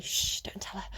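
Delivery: hushed conspiratorial whisper; playful whisper. Pretend. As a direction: whisper it like a shared secret.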